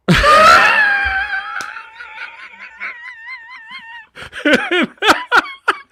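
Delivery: very high, loud, drawn-out shriek; shrieking laughter. Acted. Teller right after the joke's punchline, shrieking loudly. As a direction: let out a long squealing howl.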